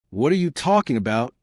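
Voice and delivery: ordinary delivery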